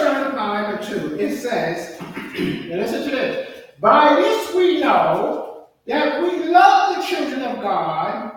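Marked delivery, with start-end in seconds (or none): 3.8-8.3 slow, loud preached reading; sermon cadence, sung out; preaching scripture. Acted. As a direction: draw out each word for the church.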